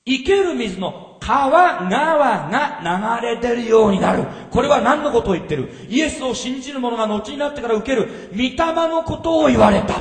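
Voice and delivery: 声を張り上げて高らかに説く; 会衆を鼓舞するように力強く; 熱のこもった説教. Acted. Loud preaching. (会衆に向かって荒れ野の喜びを説く、熱く高らかに)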